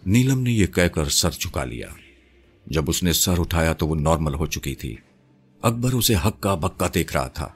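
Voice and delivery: usual speaking voice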